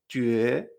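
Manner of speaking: 觉 drawn out and rising like a question; puzzled rising tone. Shown as a demonstration. Teacher likens tone to surprise, 觉 rising questioningly. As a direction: lift 觉 as if taken aback. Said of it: "nos extrañamos también al principio".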